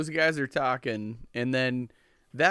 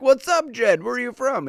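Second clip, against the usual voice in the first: high, lively UK greeting; light mock UK accent in a high voice; imitated UK accent; 12 semitones higher, 5 dB louder than usual. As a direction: imitate friendly Brits, pitched way up. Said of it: "they have this weird accent".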